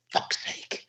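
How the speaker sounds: hushed, whispered exasperation; muttered curse. Retold or imitated. Downed opponent overheard cursing from behind, whispered exasperation. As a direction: curse under your breath, imitating the opponent.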